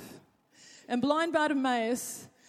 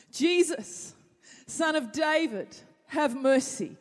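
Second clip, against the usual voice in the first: calling out; 5 semitones higher, 6 dB louder, slower than usual. Acted. Blind beggar shouts to Jesus.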